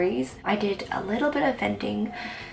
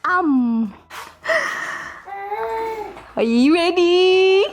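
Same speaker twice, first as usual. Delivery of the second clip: airplane spoon game; 10 semitones higher, 8 dB louder, much slower than usual. Real, not acted. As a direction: squeal it like zooming a spoon in.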